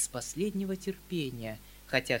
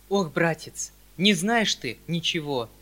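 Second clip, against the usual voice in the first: louder exasperated cry; a touch of an exclamation, louder; mild exclamation; 3 semitones higher, 8 dB louder than usual. Acted. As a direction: cry out with a wave of the hand. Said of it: "вскрикнул он".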